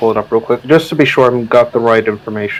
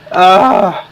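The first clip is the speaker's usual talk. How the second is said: high yelp of burning pain; 7 semitones higher, 4 dB louder than usual. Real, not acted. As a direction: cry out as the nose stings. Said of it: "My sinuses are on fire".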